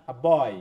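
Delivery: weak আ, then punch বয়; stress on boy. Shown as a demonstration. Teacher demonstrating the stress of আ, বয় to the class, weak article then stressed বয়. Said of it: "দেখো, আ উচ্চারণ হচ্ছে না".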